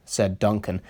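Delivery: ordinary delivery, nothing marked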